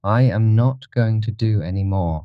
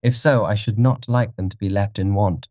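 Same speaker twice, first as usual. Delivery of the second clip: mildly bored gentleman; 3 semitones higher than usual. A narrator's reading. Dorian asks about the dead man, mildly bored. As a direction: offer help with understated indifference. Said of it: "asked Dorian, looking bored".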